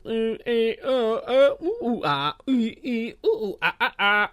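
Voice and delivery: high "ooh ooh ah" monkey-like hoots; goofy hooting noises. Pretend. Host goofing off to close his intro, silly hooting. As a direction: hoot playfully, high and laughing. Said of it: "me being all".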